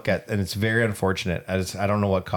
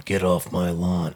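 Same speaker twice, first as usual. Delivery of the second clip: put-on low, gruff old man; grumpy old man voice; 4 semitones lower than usual. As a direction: shoo kids off the lawn. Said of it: "Grumpy old man".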